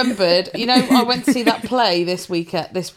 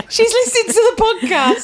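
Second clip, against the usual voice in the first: high, astonished aside; shocked; 12 semitones higher, 3 dB louder than usual. Retold or imitated. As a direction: play it wide-eyed and shocked. Mock shocked whisper to friend.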